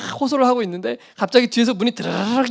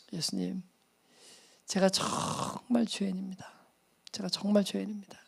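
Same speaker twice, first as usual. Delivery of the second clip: repentant prayer; 14 dB quieter than usual. Retold or imitated. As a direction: confess humbly before Jesus. Confessing prayer to Jesus.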